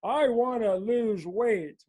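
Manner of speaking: lightly put-on, drawn-out earnest wish; someone declaring a goal. Coach voicing someone who wants help, lightly put-on slow declaration. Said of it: "Like when someone says".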